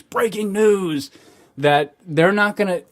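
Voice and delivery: lightly hammed-up news announcer; mock breaking-news alert. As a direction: parody crisis-mode headline. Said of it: "is such crisis mode and everything's".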